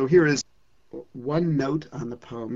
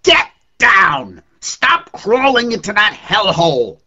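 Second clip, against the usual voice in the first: furious shout, much louder and higher; yelling at the sun; 10 semitones higher, 15 dB louder than usual. Acted. Poet yelling at the sinking sun, full furious shout. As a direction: bellow it up at the sky in a rage. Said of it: "I yelled at the sun point blank".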